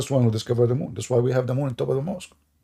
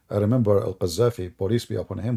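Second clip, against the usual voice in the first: ordinary delivery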